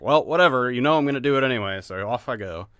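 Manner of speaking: mock resignation. Voicing bored player.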